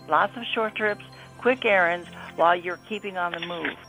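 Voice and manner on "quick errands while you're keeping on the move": normal talking voice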